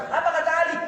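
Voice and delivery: normal talking voice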